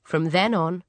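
plain reading voice

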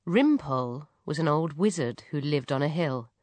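ordinary delivery, nothing marked

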